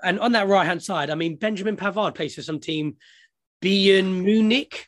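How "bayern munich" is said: "Bayern Munich" said very slowly and carefully, over-enunciated; mock German pronunciation. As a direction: spell out "Bayern Munich" slowly, like an unfamiliar foreign name. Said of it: "I don't know if I'm pronouncing that correctly".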